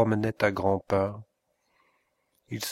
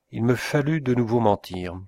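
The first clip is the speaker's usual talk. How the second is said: ordinary delivery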